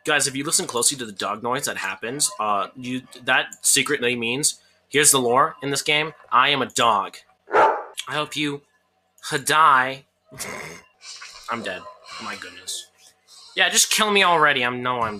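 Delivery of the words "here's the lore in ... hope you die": ordinary delivery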